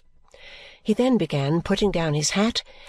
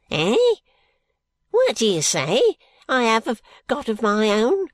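put-on high, loud, straining old voice; deaf old man asking; 4 semitones higher, 3 dB louder than usual. A narrator's reading. Deaf Smallweed cupping his ear, high and loud. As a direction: shout it as if half deaf. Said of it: "with his hand to his ear".

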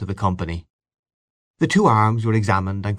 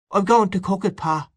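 put-on high child's voice; reply high and small, as a child; little boy; 10 semitones higher than usual. A narrator's reading. Little boy placates his hungry father, high child voice. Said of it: "said the little boy".

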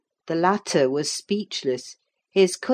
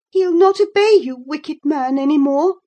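very high, loud taunt; shrill-pitched defiance; defiant young lady; 9 semitones higher, 7 dB louder than usual. A narrator's reading. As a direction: crow it at him, voice raised high. Catherine taunts Heathcliff.

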